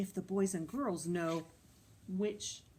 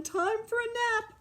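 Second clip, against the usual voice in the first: sing-song. Acted. Sleepy cue.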